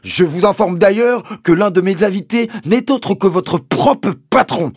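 high, loud, emphatic tirade; Mister Hazel threatening. A narrator's reading. Landowner threatening the sergeant with his boss, high angry hectoring. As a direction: jab each threat out in a shout. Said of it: "s'écria mister Hazel, en donnant de petits coups".

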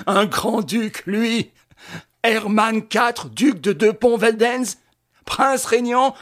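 high, loud, slow mocking laugh; sneering laughter. A narrator's reading. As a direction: Lupin mocking the fake grand duke, jeering. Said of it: "Il ricanait rageusement". (ricanement moqueur et fort)

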